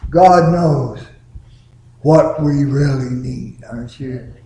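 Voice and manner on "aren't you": ordinary talk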